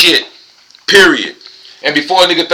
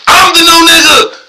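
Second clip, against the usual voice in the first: high, loud triumphant yell; a jubilant whoop of pure self-hype; hyped shout; 14 semitones higher, 5 dB louder, slower than usual. Real, not acted. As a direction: shout it out like you just won. Hyped boast after the tequila.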